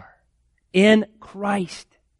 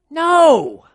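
much higher and louder, a sharp cry of refusal; preacher's loud, high "No!"; emphatic no; 9 semitones higher, 6 dB louder than usual. Real, not acted. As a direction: shout it down, no way. Rejects the unloving father reply.

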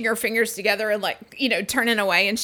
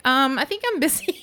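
laughing friend; 5 semitones higher than usual. Retold or imitated. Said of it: "She's like".

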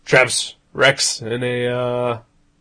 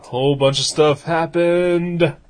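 dramatic announcer; 5 semitones higher, 3 dB louder than usual. Pretend. Repeats partner's line as announcer.

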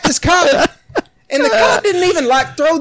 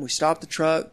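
ordinary talk, nothing marked

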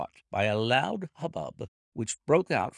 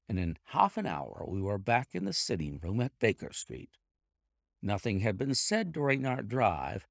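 ordinary delivery

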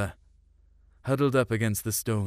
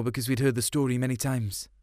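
plain reading voice

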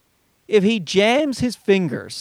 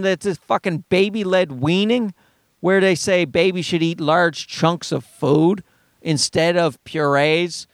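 usual speaking voice

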